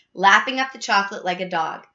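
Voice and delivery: ordinary delivery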